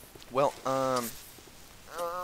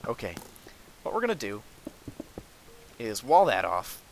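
normal talking voice

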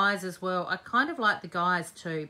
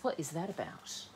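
whiny voice; 3 semitones lower, 10 dB quieter than usual. Pretend. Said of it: "I say in the whiny voice".

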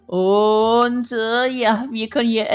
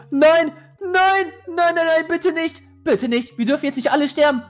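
high pleading panic; panicking gamer; 7 semitones higher than usual. Real, not acted. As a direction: beg desperately, pitch up high. Monster nearby.

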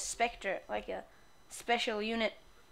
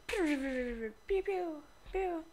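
high little pew blasts; a few playful laser pews; trailing blaster noises; laser sound effects; 6 semitones higher than usual. Pretend. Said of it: "I'm gonna make sound effects".

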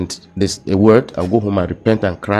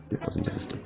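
softer, whispered echo of the line; whispered repeat; 16 dB quieter, faster than usual. Real, not acted. Echoing what he read to the host, whispered. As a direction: repeat it under the breath to yourself. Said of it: "repeats it".

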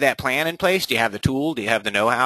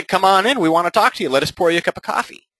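higher, louder eager welcome; welcoming homeowner; 7 semitones higher, 3 dB louder than usual. Retold or imitated. Homeowner behind on loan welcoming the agent, eager. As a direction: invite the agent in warmly. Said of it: "they're gonna say".

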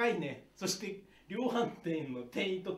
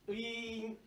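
door whoosh sound; 7 semitones higher, 4 dB quieter, slower than usual. Acted. Imitating automatic door.

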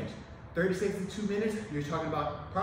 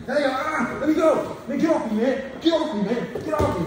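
loud, higher shouts of a suspect fighting to get free; panicked detainee yelling to be let go; resisting suspect; 7 semitones higher, 7 dB louder than usual. Acted. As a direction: yell and struggle against the officer's hold. Trainee acting a resisting suspect.

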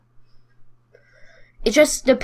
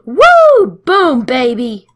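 triumphant whoop; 2 semitones higher, 8 dB louder, much slower than usual. Real, not acted. Gamer celebrating a kill, loud whoop. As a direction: cheer the kill.

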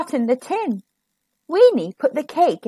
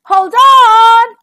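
loud, high, stretched warning; big urgent storybook call; crying out; 10 semitones higher, 11 dB louder than usual. A narrator's reading. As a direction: shout it to the rider. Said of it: "it cried".

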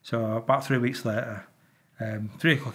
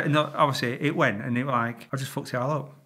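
ordinary talk

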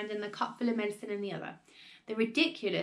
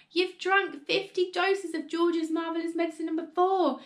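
high, agitated lament; raised-pitch, fretful wailing; dismayed wail; 8 semitones higher, 3 dB louder than usual. A narrator's reading. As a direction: warn her in horrified disbelief. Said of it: "wailed Missus Cranky".

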